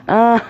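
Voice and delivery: loud, high burst of put-on laughter; acted laugh. A narrator's reading. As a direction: laugh out loud as the naughty boy. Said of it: "Sam laughed and laughed".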